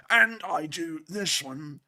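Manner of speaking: very slow, drawn-out British accent demo; slow British character voice; another British accent. Shown as a demonstration. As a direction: stretch each word in a put-on British accent. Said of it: "We actually do several different ones".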